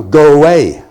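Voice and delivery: loud, curt brush-off; a mock farmer telling a stranger to go away; gruff go away. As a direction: bark it at the stranger on the doorstep. Imagined farmer dismissing stranger.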